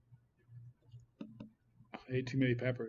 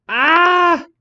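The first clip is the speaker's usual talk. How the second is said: excited yelp; 14 dB louder than usual. Real, not acted. Shows off the placenta.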